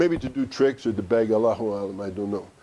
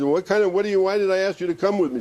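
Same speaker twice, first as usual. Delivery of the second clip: higher, raised mock outburst at the saint; mock angry shout; 7 semitones higher than usual. Husband berating the wali who lost his money, mock angry shout. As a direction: pitch it up like an upset husband scolding. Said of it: "he's shouting at the wali".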